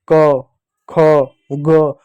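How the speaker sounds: क, ख, ग each said slowly with a clear final अ; separated teaching syllables; letter sounds demo. Shown as a demonstration. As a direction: sound out क, ख, ग like a teacher, letting the अ ring at the end. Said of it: "सब में देखो last में अ आता है".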